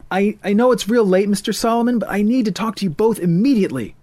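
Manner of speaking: put-on high, urgent young man's voice; pleading urgently at the late hour; anxious young man. Voices Ethan, agitated.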